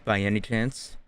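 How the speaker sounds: normal talking voice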